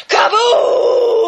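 mock artillery explosion; shell blast. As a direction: boom it out like a shell hitting. Narrator imitating shell blast.

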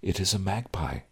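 soft, patient sigh; sighing correction. Acted. Groom patiently correcting his bride, softly sighing. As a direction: quietly correct her, long-suffering. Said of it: "I sighed".

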